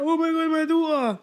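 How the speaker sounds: high-pitched mock wail; distraught mum. Mum seeing her daughter wake, acted distraught cry. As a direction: imitate an overwrought mother crying out.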